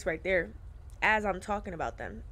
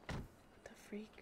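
whispered; 18 dB quieter than usual. Real, not acted. Shocked at the fuel pump.

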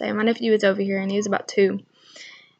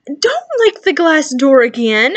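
firm scolding; 5 semitones higher, 8 dB louder, slower than usual. Retold or imitated. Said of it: "And I was like".

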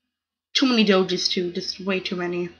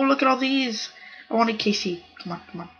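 usual speaking voice

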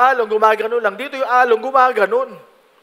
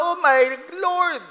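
high, slow "oh my Lord" in mock dread; acted gasp of alarm; awed alarm; 7 semitones higher, slower than usual. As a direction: voice the frightened fishermen. Disciples voiced in the storm.

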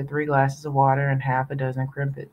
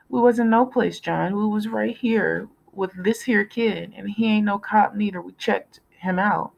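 put-on 1 pleading kid; 6 semitones higher than usual. A narrator's reading. Kids pleading with big John.

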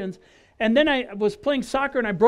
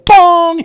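bong sound; 7 semitones higher, 17 dB louder than usual. Retold or imitated. People walking into poles.

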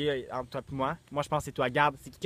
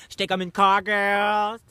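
excited; 5 semitones higher, 7 dB louder, slower than usual. Real, not acted. Showing off cowboy hat.

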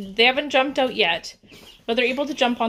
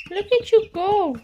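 high cooing pet voice; 10 semitones higher, 5 dB louder than usual. Real, not acted. Cooing to chicks.

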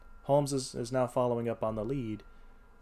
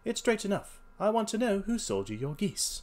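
high-pitched, firm detective; insistent detective; 10 semitones higher than usual. Acted. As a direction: press the question in a raised character voice. Holmes presses the salesman.

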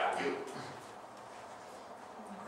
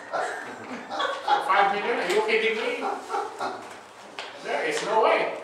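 laughing disbelief; 7 semitones higher, 5 dB louder, slower than usual. Pretend. Laughing refusal to pay ransom.

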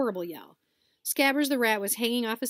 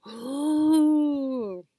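higher, louder awed crowd ooh; 6 semitones higher, 7 dB louder than usual. Acted. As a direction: gasp in wonder. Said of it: "There was a loud".

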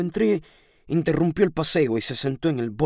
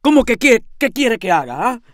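loud, indignant yell, starting high; shouting; 5 semitones higher, 6 dB louder than usual. A narrator's reading. Landlady shouting back at her helpless lodger, indignantly. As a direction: throw the question back in a shout. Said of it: "grité".

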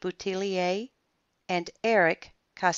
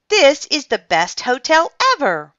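high, loud gushing; delighted cry; 9 semitones higher, 11 dB louder than usual. A narrator's reading. Francine praising the hotel, delighted cry. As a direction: proclaim it with glee. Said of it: "cried Francine".

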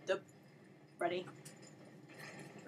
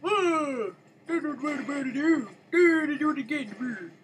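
high, loud, babbling comic voice; Swedish chef; 6 semitones higher, 8 dB louder than usual. Acted. Gamer bemoaning a mistake, Swedish chef impression. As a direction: wail it like a comic Swedish cook. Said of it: "That's my Swedish chef impression".

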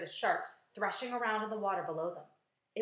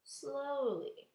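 ordinary delivery

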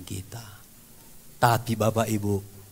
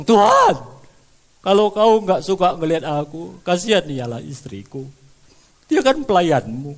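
loud, high, bargaining outcry; shouted demand for healing, fading on the last word; angry pleading; 6 semitones higher, 10 dB louder than usual. Retold or imitated. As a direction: threaten and beg God at once. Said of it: "marah pula dia sama Tuhan".

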